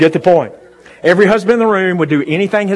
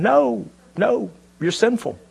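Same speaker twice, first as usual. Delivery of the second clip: usual speaking voice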